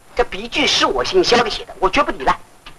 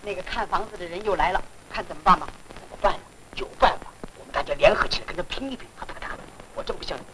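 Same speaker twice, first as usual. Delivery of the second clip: soft, low-key plotting; hushed conferring; 3 semitones lower, 10 dB quieter, faster than usual. Acted. Tenants plotting as the house buyer returns, hushed conferring. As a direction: keep it down, well below talking level.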